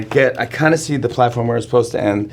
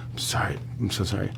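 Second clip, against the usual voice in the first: shaky whispered apology; imitating a fake-distraught whisper; 3 semitones lower, 11 dB quieter than usual. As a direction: whisper it as if fleeing in tears. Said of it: "She goes".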